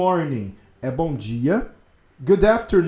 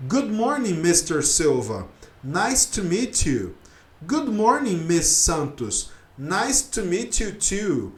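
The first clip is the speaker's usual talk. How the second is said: slow formal dialogue; slower than usual. Shown as a demonstration. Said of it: "que é assim, ó".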